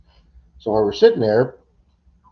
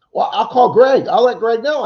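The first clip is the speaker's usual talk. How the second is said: high, loud retold protest; insistent protest; 13 semitones higher, 8 dB louder than usual. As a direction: imitate a friend insisting he can fix it. Retold phone argument.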